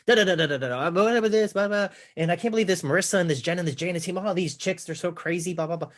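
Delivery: high-pitched gossipy babble that will not stop; mock chattering wife. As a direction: imitate a wife rattling on excitedly. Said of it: "she still can't stop herself".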